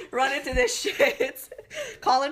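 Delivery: real laughter breaking through the words; laughing about the poor Lyft driver. Real, not acted.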